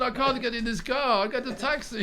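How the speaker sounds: put-on high, insistent Polish dad accent; stubborn dad in a Polish accent. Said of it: "I keep doing the Polish accent".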